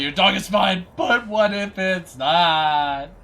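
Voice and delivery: loud, slow, high anxious owner voice; mock worried owner. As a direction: draw it out like a paranoid owner. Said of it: "It's like".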